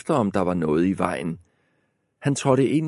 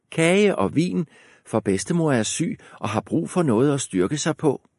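put-on high girlish voice; girl voice, higher; 7 semitones higher than usual. A narrator's reading. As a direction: chirp it like a small girl.

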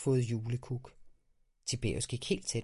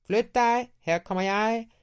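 much higher, louder yell; shouted warning; 12 semitones higher, 9 dB louder than usual. A narrator's reading. Boy on a sled yells to get past. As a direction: clear the way with a shout. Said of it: "der råbte".

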